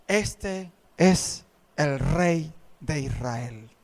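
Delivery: very low, slow and grave; solemn prophet. Retold or imitated. Prophet anointing David, low slow solemn proclamation. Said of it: "el profeta dijo".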